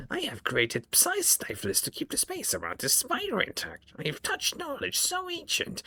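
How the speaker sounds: high, eerie surgeon creature voice; creepy homunculus voice. Acted. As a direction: voice a gloating flesh-crafter, high and strange. Homunculus voice continues.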